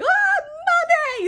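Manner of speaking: high, loud mock scream; hammed-up panicked shriek; freaking out. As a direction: yelp in comic terror. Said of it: "he's freaking the fuck out".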